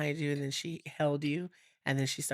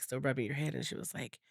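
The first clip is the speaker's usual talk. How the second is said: usual speaking voice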